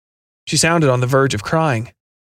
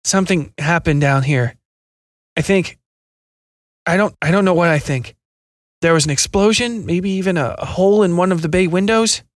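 higher, strained with hidden pain; 5 semitones higher than usual. A narrator's reading. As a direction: hold back the pain as you speak. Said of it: "barely able to keep the pain out of his voice".

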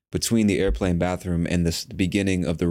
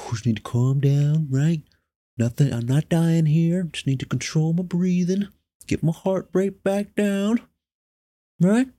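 high, strained voice climbing higher; panicked self-soothing; 7 semitones higher than usual. Retold or imitated. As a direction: talk yourself down on held breath, pitch creeping up. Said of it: "you're just trying to breathe".

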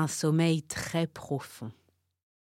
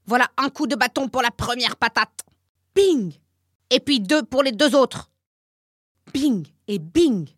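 loud, higher, punishing voice with bings; wooden man beating; 9 semitones higher, 9 dB louder than usual. A narrator's reading. Wooden man punishes the turtle, loud with each bing. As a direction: count out each blow loudly. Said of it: "lui dit-il".

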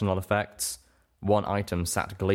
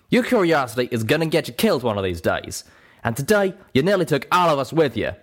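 loud, heated scolding, pitch raised; angry growling rebuke; 7 semitones higher, 5 dB louder than usual. A narrator's reading. Calamity scolds Little Pip over dangerous curiosity, loud anger. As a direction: tear into someone for their recklessness. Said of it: "Calamity growled back angrily".

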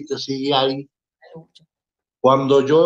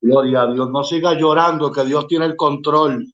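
worship praise; 2 semitones lower than usual. Acted. Preacher leading the studio in praise, fervent. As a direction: proclaim it with fervent faith.

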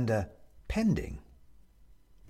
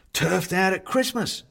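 bright, higher, quick chatty host voice; cheerful Bobs; 8 semitones higher, 4 dB louder than usual. Acted. Bobs reassuring the ghost guest, cheery and quick. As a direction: fuss over the guest kindly.

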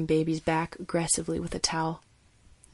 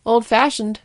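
louder, a touch higher remark; dry comment; 5 semitones higher, 11 dB louder, slower than usual. A narrator's reading. Colleague remarking on the old tool, louder. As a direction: toss the comment across the table. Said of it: "Hernandez commented".

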